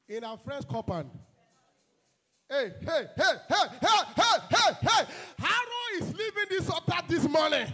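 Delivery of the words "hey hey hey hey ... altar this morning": rising chant of hey into loud, high decrees; fiery prayer shout. Acted. Preacher leading a warfare prayer, a building roar. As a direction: build from a call into a roaring declaration.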